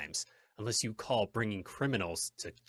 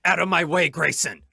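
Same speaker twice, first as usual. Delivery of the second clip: loud, high commanding shout; urgent shout; 9 semitones higher, 14 dB louder than usual. Acted. Hornet pushing past the judge to give chase, urgent shout. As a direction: order him aside at full voice.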